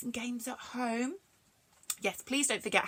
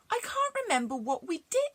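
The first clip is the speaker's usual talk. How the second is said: put-on higher little girl voice; girl asking her friend, lightly raised; Mary's voice; 9 semitones higher, faster than usual. A narrator's reading. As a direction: pitch up as young Mary. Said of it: "said Mary".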